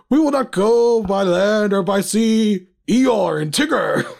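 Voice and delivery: grand drawn-out oratory, played for a laugh; hammed-up British statesman rallying the nation; Winston Churchill. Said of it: "guy who used to do Winston Churchill's speeches".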